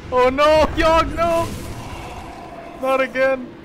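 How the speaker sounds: dismayed outcry. Real, not acted. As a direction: cry out as the game turns on you. Spells going wrong in game.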